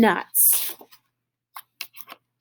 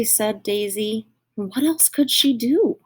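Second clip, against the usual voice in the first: usual speaking voice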